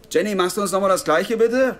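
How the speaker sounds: loud, high call across the room. Acted. Ordering a refill from waitress Jenny, loud high call. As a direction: call over to the counter.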